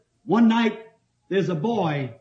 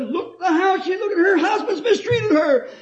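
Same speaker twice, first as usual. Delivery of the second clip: mock crying plea; 12 semitones higher than usual. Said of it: "I cried a little".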